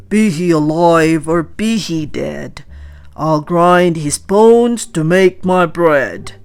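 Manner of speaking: loud, booming giant threat; menacing giant. A narrator's reading. Giant threatening to grind bones, booming loud. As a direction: boom the threat like a hungry giant. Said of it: "he said".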